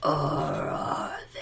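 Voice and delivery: ghostly unvoiced whisper; low haunting voice. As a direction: breathe it like a ghost from the walls. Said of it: "That was a spooky voice".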